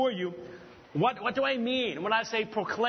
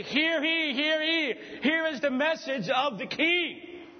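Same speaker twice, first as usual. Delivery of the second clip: higher-pitched herald's cry; town herald; 5 semitones higher than usual. Acted. Herald sent by the king announces to a town, proclaiming. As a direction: proclaim it like a royal messenger without a microphone. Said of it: "Taken from a herald sent by the king".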